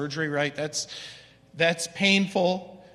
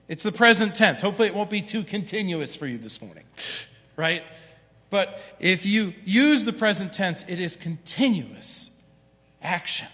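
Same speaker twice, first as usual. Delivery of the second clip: ordinary talk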